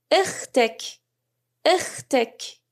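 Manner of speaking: اختک said slowly and clearly, "ukhtak"; Arabic demo. Shown as a demonstration. Teacher modelling اختک as "ukhtak" for learners, careful.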